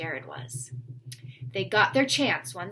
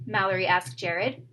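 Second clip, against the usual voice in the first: plain reading voice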